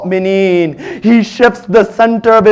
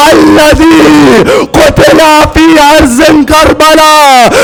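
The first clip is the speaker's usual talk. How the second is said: raised, wailing preacher's lament; mourning lament; 3 semitones higher than usual. Acted. Preacher recounting Karbala to the congregation, wailing lament. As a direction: cry out the tragedy to the mourners.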